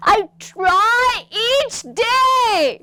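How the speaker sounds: high, loud, very slow sobbing shout; crying out. Shown as a demonstration. Teacher showing a student's anguished line, crying and shouting. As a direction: wail it like it breaks you. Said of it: "बताओ कि".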